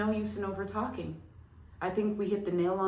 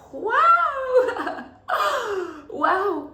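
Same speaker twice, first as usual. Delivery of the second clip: excited wow; 10 dB louder than usual. Real, not acted. Sudden realization mid-talk.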